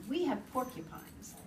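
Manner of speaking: usual speaking voice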